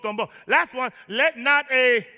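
ordinary talk

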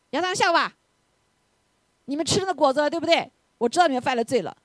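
high, loud stern call-out; mock accusation. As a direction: act out a Father catching out the guilty. Imagined Father accusing Adam, Eve.

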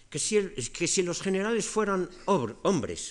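Cleón gritando alto y fuerte en la asamblea; forma muy fuerte de hablar. Retold or imitated. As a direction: imitando a un demagogo que grita "que si los generales". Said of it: "una manera muy fuerte de hablar". (arenga alta y fuerte, imitada)

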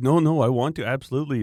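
emphatic mock customer refusing no for an answer; insistent customer. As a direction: push back warmly but firmly, like a keen buyer.

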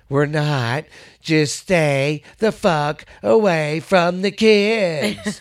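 slow, drawn-out warning; blunt retort. Retold or imitated. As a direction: spell it out slowly to him. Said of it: "people are going".

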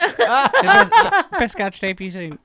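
high Southern character voice; Darlene. Acted. Darlene bragging during the bit, high Southern character. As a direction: boast in a high, put-on twang. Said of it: "I'm Darlene".

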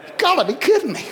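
high, incredulous exclamation; amused disbelief. Real, not acted. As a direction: react as if it's absurd.

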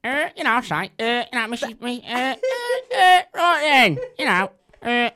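hammed-up local comic voice; loud mock Gary Poundland; Gary Poundland impression. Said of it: "That's Gary Poundland".

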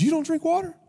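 very high, incredulous mock voice; imitating Dwayne. Friend shocked the pastor skips water, high mock voice. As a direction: play it shocked and squeaky. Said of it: "he goes".

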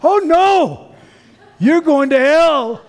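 put-on high, slow, alarmed child voice; a first-grader worried for his friend; imitating a little kid's dismay; little boy voice. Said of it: "He goes".

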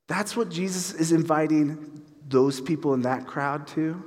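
ordinary delivery

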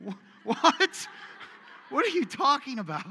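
high, loud, incredulous laugh through it; mock baffled outburst; laughing disbelief. Preacher imitating his own confused reaction. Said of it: "I'm like".

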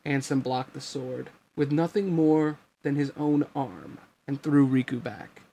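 usual speaking voice

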